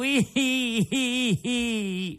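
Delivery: high wailing sob; puppet wailing sob. Acted. Pinocchio breaking into wails before Geppetto, high wailing sob. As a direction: burst into a loud puppet wail. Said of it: "cominciò a piangere e a berciare".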